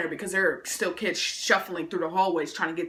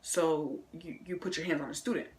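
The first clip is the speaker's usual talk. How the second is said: principal; 3 semitones lower, 9 dB quieter than usual. Retold or imitated. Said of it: "he's like".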